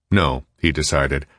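plain reading voice

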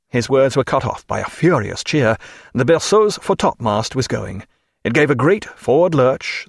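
ordinary delivery

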